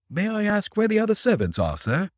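high-pitched put-on Yankee twang. A narrator's reading. Disguised detective questions his employer in an imitated Yankee twang. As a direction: ask politely as a Yankee coachman. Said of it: "imitating Gilder's Yankee twang".

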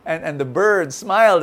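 usual speaking voice

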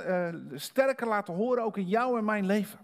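ordinary delivery

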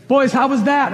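dad calling down from the crane; retold yell from the crane. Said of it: "he would yell from the crane".

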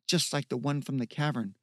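plain reading voice

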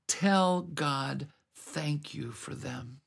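normal talking voice

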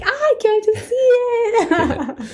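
high, slow, giddy and laughing; excited squeal. Retold or imitated. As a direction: gush like you can't wait, laughing. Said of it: "So I was like".

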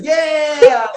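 high, loud mock cheer; a crowd cheering a car; cheering yeah. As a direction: whoop it like the crowd. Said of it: "everyone around her is like".

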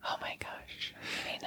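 breathless whispered gasp. Real, not acted. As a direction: hush it out in disbelief. Shocked reaction to partner.

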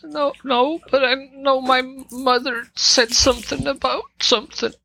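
put-on high-pitched girl's voice; Sophia voice. GM voices Sophia answering questions.

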